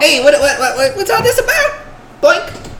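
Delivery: high, laughing halfling voice. Acted. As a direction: squeak it out as a giggly halfling. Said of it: "I done already forgot his voice".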